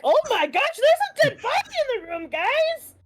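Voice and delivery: rising to a high alarmed cry; sudden shock. Real, not acted. Player suddenly noticing the dead body, high alarmed cry. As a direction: blurt it out in shock.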